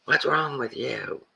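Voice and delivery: high, slow, nagging shopper voice; mock shrill scolding. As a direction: mimic an outraged masker. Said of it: "are screaming at you".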